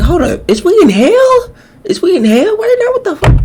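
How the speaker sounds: very high-pitched disbelief; comically alarmed high reaction; incredulous. Real, not acted. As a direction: question it in a squeaky, stretched-out voice. Startled reaction interrupting the clip.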